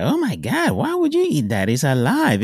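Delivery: shrieking high mock disgust; disgusted shock. Mocking a coworker's horror at his food, high shocked voice. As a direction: imitate a horrified coworker, pitch way up. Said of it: "somebody was like".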